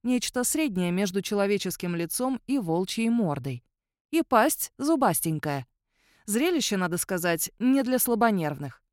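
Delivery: ordinary delivery, nothing marked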